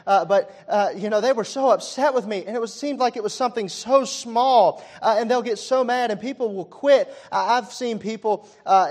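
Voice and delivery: high, loud fired-up preaching; full-force preaching. Acted. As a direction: preach it hard to the congregation.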